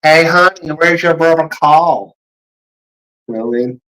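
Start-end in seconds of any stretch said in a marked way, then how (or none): 0.0-2.2 loud, higher put-on Southern drawl; stretched-out bad Southern accent; attempted American Southern accent. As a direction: call out in a hammed-up Southern accent. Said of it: "I can't do it".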